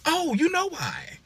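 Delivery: high, slow, knowing tone; knowing sass. Acted. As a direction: drag it out like you know the secret. Knowing sassy aside.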